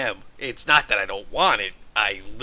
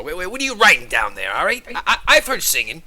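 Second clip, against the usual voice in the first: jittery paranoid; 5 semitones higher, 6 dB louder, faster than usual. Acted. Suspicious paranoid character.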